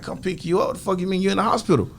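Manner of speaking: retold shocked reply to a friend; incredulous outburst. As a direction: fire it back in disbelief. Retelling shock Rob left hospital.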